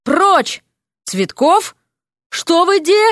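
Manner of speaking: high, startled shouting; high, urgent exclamations; shouted command. Acted. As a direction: bark orders, then break off. Office owner shouting through door.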